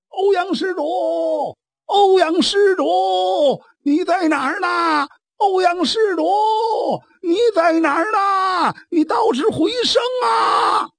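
high, loud shouts calling a lost companion across the mountainside; calling out loudly. Acted. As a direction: yell his name up the slope, pitch high and straining, growing desperate. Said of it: "大声呼唤". (在半山腰高声大喊，越喊越急)

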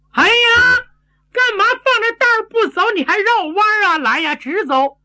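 high-pitched puzzled holler; high, impatient complaint to someone ahead; impatient calling. A narrator's reading. Impatient fighter calls to man ahead.

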